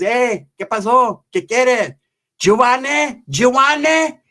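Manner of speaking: put-on high, drawn-out Spanish-accented calling; imitated paisa. Taco cook calls to the customer, imitated high voice.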